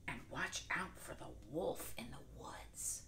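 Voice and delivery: hushed, secretive whisper; mother whispering a warning. Acted. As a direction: warn her softly about the wolf. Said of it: "mother said".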